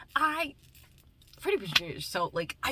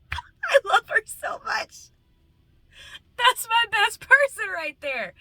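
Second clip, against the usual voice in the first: very high, loud, drawn-out gushing; overjoyed high-pitched shouting; real excited squeal; 7 dB louder, slower than usual. Real, not acted. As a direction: shriek it with affection for a best friend. Cracking up over best friend.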